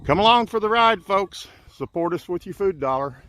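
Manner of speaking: high, loud upbeat invitation; rally the viewers; excited. Real, not acted. Enthusiastic sign-off pitch.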